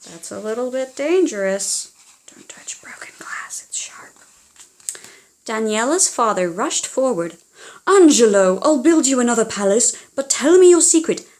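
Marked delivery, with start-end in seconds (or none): 0.1-4.2 a gentle whispered safety tip; whispered aside. Real, not acted. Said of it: "aside warning to child listener". 7.9-11.3 louder, higher, fast eager plea; father pleading urgently. Acted. Father rushes forward, urgent.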